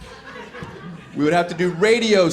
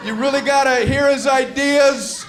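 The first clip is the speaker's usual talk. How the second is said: higher, overeager campaign pitch; hammed-up pitchman; 10 semitones higher, 3 dB louder than usual. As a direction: sell the dog like a desperate salesman. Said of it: "I'd go".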